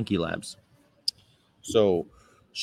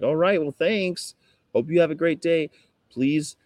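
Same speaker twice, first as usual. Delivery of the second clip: laid-on-thick niceness; 7 semitones higher than usual. Acted. Activist replying to rude people, laid-on-thick sweet. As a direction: overdo the sweetness in a high voice. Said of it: "I'll lay it on thick".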